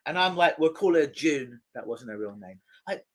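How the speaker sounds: ordinary talk, nothing marked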